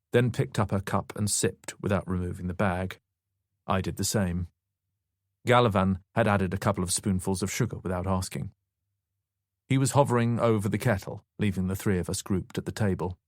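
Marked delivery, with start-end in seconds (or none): none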